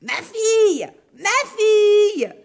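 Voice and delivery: high, loud, drawn-out ogress call. Acted. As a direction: call her daughter from outside. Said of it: "elle crie". (l'ogresse appelle sa fille, aiguë et forte)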